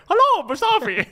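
high, loud mock German accent; imitating German accent. Mocking Theo's greeting to Mustafi, high German accent. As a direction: ham up a German accent to tease him. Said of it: "in a German accent".

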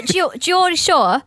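put-on high, loud Geordie accent. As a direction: belt it out like a Geordie Shore cast member. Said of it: "let me talk like the Geordie Shore".

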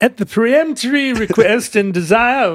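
grand but wobbly mock-British accent; bad British accent. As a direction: read it out in a dodgy put-on British accent. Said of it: "And he's British, right?".

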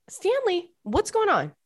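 high-pitched call to a pet; talking to pet. Real, not acted. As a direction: ask the pet in a lifted, singsong pitch. Calls to pet mid-show.

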